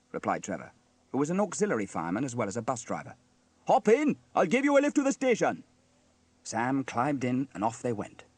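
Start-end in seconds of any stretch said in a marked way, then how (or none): 3.7-5.6 high, loud friendly call. A narrator's reading. Trevor offers Sam a lift, calling out. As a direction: call a passenger aboard.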